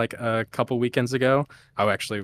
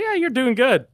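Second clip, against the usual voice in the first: much higher, breezy mock praise; imitating mentor; 13 semitones higher, 4 dB louder than usual. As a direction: toss off a lazy compliment. Quoting the lazy mentor.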